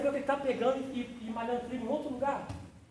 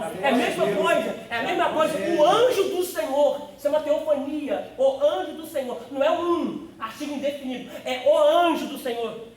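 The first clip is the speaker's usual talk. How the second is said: emphatic preaching; 3 semitones higher, 8 dB louder than usual. Acted. Preacher explaining the angel of the Lord, loud and emphatic. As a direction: hammer the point home.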